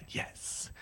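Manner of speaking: hushed, expectant whisper; whispered yes. Retold or imitated. Storyteller answering the prophet who called their name, whispering. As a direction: breathe out a tiny reverent yes. Awed reply to hearing name.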